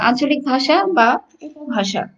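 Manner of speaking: ordinary delivery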